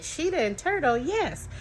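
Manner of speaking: high-pitched imitation of a delighted little kid; excited child. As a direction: squeal it lightly like a child keen to play. Retelling excited child's reply.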